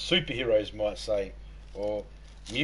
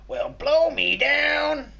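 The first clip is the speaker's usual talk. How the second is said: high, slow cartoon sailor croak; Popeye voice; 5 dB louder, much slower than usual. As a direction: growl it out like Popeye. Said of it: "that was Popeye".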